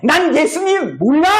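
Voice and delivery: high, forceful acted oath; emphatic high-pitched disowning; sworn denial. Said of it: "맹세하는 거예요".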